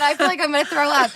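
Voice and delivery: half-laugh out the dread; nervous laughing. Retold or imitated. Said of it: "I said".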